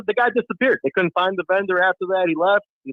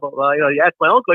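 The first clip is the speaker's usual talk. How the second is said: normal talking voice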